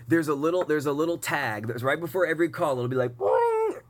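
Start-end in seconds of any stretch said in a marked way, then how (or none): 3.2-3.8 high cartoon boing; alert boing. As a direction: imitate a notification chime. Mimicking the call alert sound.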